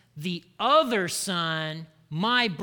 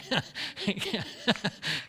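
light laugh. Real, not acted. Joking about family gossip.

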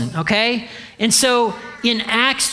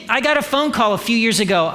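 normal talking voice

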